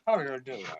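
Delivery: low, quiet drawn greeting; deep voice greeting. Pretend. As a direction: drop the voice way down.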